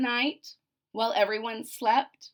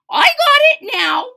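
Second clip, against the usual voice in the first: very high, loud triumph; cocky shout; 14 dB louder than usual. Acted. Pupil brags to everyone, high and loud. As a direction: yell it, sure of yourself. Antonia cocky before spell.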